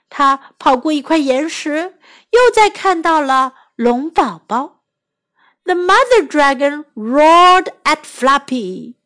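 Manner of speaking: ordinary delivery, nothing marked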